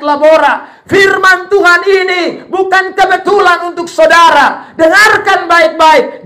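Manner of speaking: loud, high-pitched sermon climax; fiery preacher shouting his call; preaching at full force. Acted. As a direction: drive the appeal home to the congregation.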